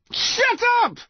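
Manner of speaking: high, strained yell; desperate shout. A narrator's reading. Son shouting at the yew tree behind him. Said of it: "Connor shouted".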